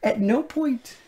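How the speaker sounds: high-pitched protest; exasperated disbelief. Real, not acted. Co-host objecting to the absurd gunshot, high exasperation.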